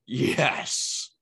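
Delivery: breath-only, drawn-out "yes"; savoring whisper. Acted. Raving about a super flavored Dorito, savoring whisper. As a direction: whisper it with relish.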